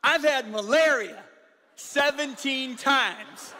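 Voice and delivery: loud, high, drawn-out retelling; quoted astonishing claim. Retold or imitated. Preacher retelling a missionary's malaria count, loud and drawn out. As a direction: punch it out slowly and high to the crowd.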